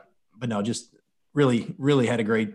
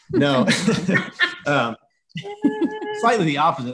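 laughing; 13 semitones higher, 7 dB louder than usual. Real, not acted. Breaks off joke, laughing.

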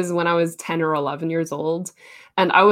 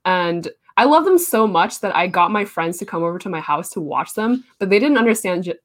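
ordinary talk

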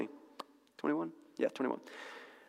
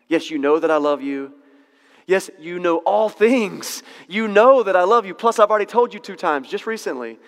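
louder, insistent, building protest; defensive; 3 semitones higher, 5 dB louder than usual. Acted. Peter answering Jesus a third time, defensive and loud. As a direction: push back harder with each yes. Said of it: "he gets a little bit more defensive".

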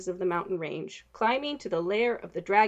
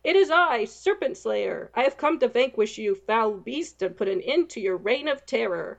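heroic declaration; 3 semitones higher, 5 dB louder than usual. Acted. Knight proclaiming his quest to the dragon. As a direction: proclaim it grandly to the beast.

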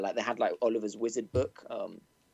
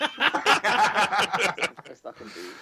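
laughing; 9 semitones higher, 6 dB louder, much slower than usual. Real, not acted. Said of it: "Best I, no, it's not".

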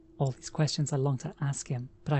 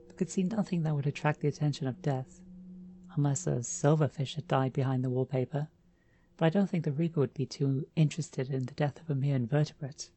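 ordinary delivery, nothing marked

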